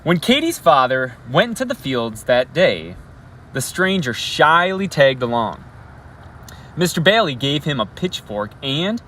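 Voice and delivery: storyteller voice projecting to a classroom; story read-aloud. Acted. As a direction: read the picture book to the kids with energy. Teacher reads story aloud.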